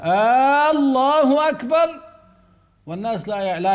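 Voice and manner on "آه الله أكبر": "آه، الله أكبر" long, rising much higher and louder; a chanting imam who stretches the opening of "الله"; imitating drawn-out takbir. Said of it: "عند الخفض وعند الاعتدال هكذا". (تكبير ممدود بصوت أعلى)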